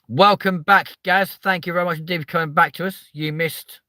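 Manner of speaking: high, loud host welcome; mock show host. Welcoming Gaz back like a TV presenter, high and loud. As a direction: greet him like a presenter.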